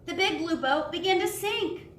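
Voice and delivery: lively storybook read with voices for each animal; animated children's story narration. A narrator's reading. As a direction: read it aloud like a bedtime picture book. Reads shipwreck and whale part.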